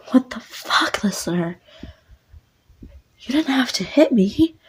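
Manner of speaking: high, whispered outrage after the slap; shocked girl. Acted.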